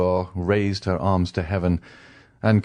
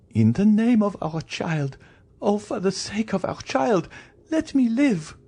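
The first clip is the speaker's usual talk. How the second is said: very high, wailing entreaty; frantic begging, climbing higher at the end; pleading wife. Acted. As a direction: implore desperately in a high woman's voice. Wife begs for her life.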